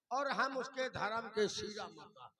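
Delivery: usual speaking voice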